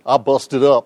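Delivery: drawn-out mock Southern twang; Southern accent. Shown as a demonstration. Speaker jokes about his own slip, exaggerated Southern accent. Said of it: "That almost sounded Southern".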